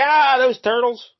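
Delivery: high, loud, drawn-out cartoon cry; mock outraged outburst. Villain spots the Turtles on TV, mock outraged cry. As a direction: play a villain exploding at the sight of his foes. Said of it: "he sees the Turtles there".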